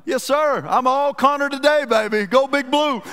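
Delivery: hyped fan shout of Go Big Blue; eager fan. Retold or imitated. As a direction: play the thrilled Kentucky fan caught up in it. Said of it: "I'm like".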